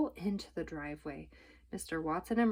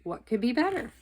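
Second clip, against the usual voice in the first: usual speaking voice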